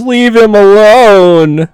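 slow, high, loud pleading voice; voicing a bystander shouting leave him alone; bystander's plea. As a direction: beg them to stop, drawn out. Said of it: "Bystander says".

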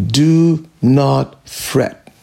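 slow, weighty do not fret; preacher slowing down to drive it home; very slow emphasis. Shown as a demonstration. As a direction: drag out do not fret, word by word. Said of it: "The second step here".

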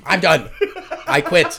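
building from firm to high, loud quitting; mock outburst. Performer quitting after the slap, mock indignant outburst rising in pitch. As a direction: escalate each refusal, half-laughing.

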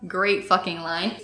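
ordinary talk, nothing marked

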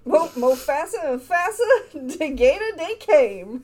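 put-on laughing through a Cajun accent. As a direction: Cajun storybook voice, giggling. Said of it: "with a Cajun accent".

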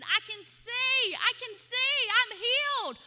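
high, loud, slow cries of joy; retold scream of sudden sight; shouting for joy at being healed; ecstatic shout. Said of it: "started screaming like a girl".

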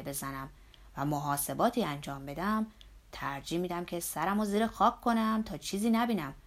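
put-on a hearty old worker nagging his boss; Zorba's voice. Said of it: "نگرانی و اضطراب در وجناتش مشهود".

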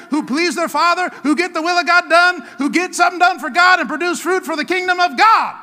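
building to a high, loud rallying shout; rousing pulpit crescendo; full-force preaching. Real, not acted.

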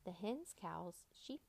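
ordinary delivery

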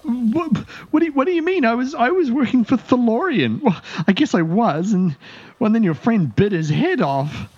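high, panicky elf voice; frightened elf captive. Acted. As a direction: stammer it out as a terrified prisoner.

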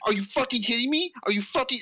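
angry snap. Retold or imitated. Boyfriend in costume snapping angrily at his girlfriend. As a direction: scold her furiously under his breath.

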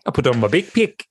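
a hint of a New Zealand accent on big pick; lightly put-on Kiwi vowels. Co-host teasing, putting on a light New Zealand accent. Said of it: "that's more New Zealand".

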